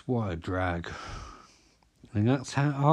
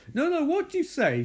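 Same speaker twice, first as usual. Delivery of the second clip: demanding Katie. Retold or imitated.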